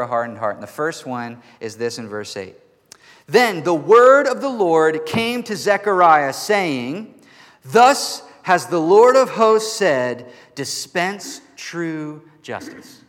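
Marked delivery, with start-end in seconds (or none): 3.3-6.3 loud, slow, ringing proclamation; booming pulpit reading of scripture; solemn scripture reading. Acted. Scripture read with music. 6.3-13.0 loud, slow declaration of the Lord's words; solemn scripture reading. Acted. Scripture read continues.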